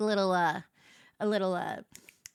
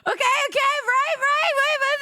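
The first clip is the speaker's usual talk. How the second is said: very high, loud mock British "right, right"; hammed-up fake British chatter; Love Island British accent; 12 semitones higher, 4 dB louder than usual. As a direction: imitate islanders on the show. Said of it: "That's what they say in the show".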